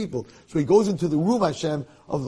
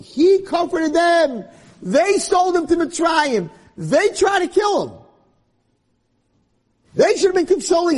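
preaching at full force; 13 semitones higher, 6 dB louder than usual. Real, not acted. Rabbi urging listeners to forgive, preaching fervently. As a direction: thunder it from the pulpit.

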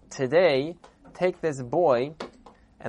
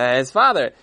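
louder, crying voice; choked up; 8 dB louder than usual. Acted. Storyteller choking up as the boy finds his father. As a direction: let the voice crack with emotion. Emotional climax, voice cracking.

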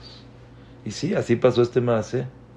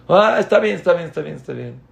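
dismissive parent; 6 semitones higher, 4 dB louder, faster than usual. Acted. Parent brushing off child.

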